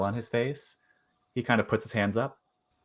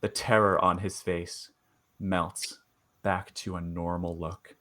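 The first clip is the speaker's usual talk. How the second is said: game master narration; 3 semitones lower, 6 dB quieter than usual. Acted. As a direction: draw out each eerie detail quietly. Game master sets eerie scene.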